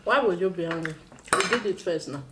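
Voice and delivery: ordinary talk, nothing marked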